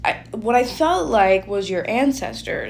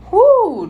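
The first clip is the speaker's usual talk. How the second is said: high, loud, drawn-out "whoo"; sudden loud whoop from chills; 12 semitones higher, 9 dB louder than usual. Real, not acted. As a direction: let out a shivery whoo.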